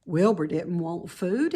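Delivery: ordinary delivery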